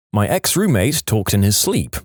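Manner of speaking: plain reading voice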